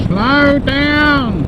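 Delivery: high, slow put-on voice; high mock voice. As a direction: squeak it out like a nag.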